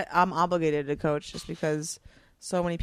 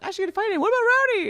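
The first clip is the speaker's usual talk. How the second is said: higher, louder retold pitch of an idea; excited brainstorm; 10 dB louder, much faster than usual. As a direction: pipe up high with a bright idea. Retelling excited name brainstorm.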